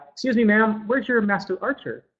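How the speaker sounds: lightly voiced young man stopping a passerby; young archer asking. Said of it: "Some lady walks by".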